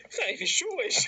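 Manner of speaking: high voice breaking into laughter; laughing retold protest. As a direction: quote the silly excuse while cracking up. Raconte en riant la réplique absurde. (protestation imitée, aiguë, en riant)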